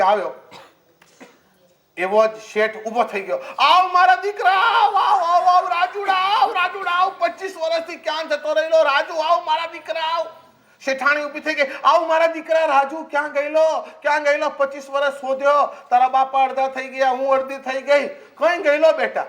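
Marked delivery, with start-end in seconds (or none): 3.6-10.3 mock tearful joy, much higher; fake joyful welcome. Merchant fakes joy at thief. 11.8-19.2 high, hammed-up mother greeting a lost son; mock motherly welcome. Wife joins the welcome.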